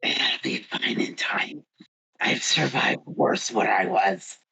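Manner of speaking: low, breathy rasping growl, slow; growly voice. Acted. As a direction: rasp like a shredded throat. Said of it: "I'm not doing the growly voice".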